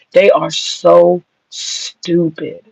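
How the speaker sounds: drawn out slowly, every word leaned on; slow emphatic disgust. Real, not acted. Ranting to his viewers about the commenters, slow emphatic disgust. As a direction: spell out each word to the commenters, slowly and deliberately.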